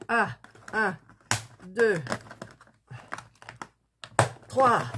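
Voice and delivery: forcing it on the count; pushing hard on each count; straining with effort. Real, not acted. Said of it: "C'est très difficile".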